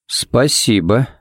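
drawn-out, unimpressed thank-you; slow, underwhelmed acknowledgement. A narrator's reading. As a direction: stretch it slowly with a questioning lift. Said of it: "явно был не впечатлён".

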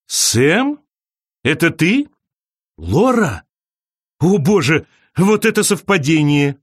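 surprised recognition, lifting in pitch; mildly amazed at running into someone; surprised to meet an acquaintance; pleasant surprise. A narrator's reading. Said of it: "удивлённо рассмеялась".

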